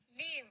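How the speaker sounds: लीव with a long ee; long-ee leave. Shown as a demonstration. Teacher drilling the class, लीव with long ee. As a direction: contrast it by lengthening the ee in लीव. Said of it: "बड़ी ई छोटी ई होता है".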